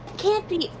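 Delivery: high, baffled coworker voice; puzzled coworkers. Retold or imitated. Retelling coworkers hunting the noise, baffled and high. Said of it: "they're like".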